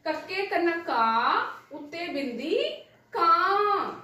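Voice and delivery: ਕੱਕੇ ਕੰਨਾ ਕਾ, ਉੱਤੇ ਬਿੰਦੀ ਕਾਂ spelled out slowly, syllable by syllable; teacher drawing out each sound of ਕਾਂ for pupils; slow spelling demo. Shown as a demonstration. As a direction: drag out ਕਾ then the nasal ਕਾਂ like a teacher sounding it out. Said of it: "ਜਿਵੇਂ ਵੇਖੋ".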